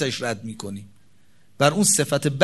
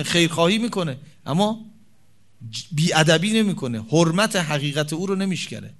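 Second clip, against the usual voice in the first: usual speaking voice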